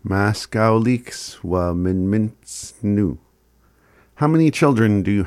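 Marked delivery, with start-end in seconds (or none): 0.0-3.2 "Maskau leaks wa min mints nu" sounded out slowly and carefully; patient teacher repeat. Shown as a demonstration. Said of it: "repeats after the recording".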